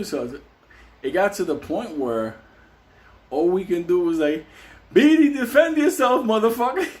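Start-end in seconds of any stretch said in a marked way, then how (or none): 4.9-6.9 loud, high cheering-on yell; urging him on. Retold or imitated. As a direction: yell at a cellmate to fight back. Cellmates urging Beatty to fight.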